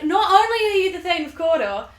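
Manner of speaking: high, loud mock announcement; witches proclaiming. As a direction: proclaim it with showy gusto. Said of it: "And they're like".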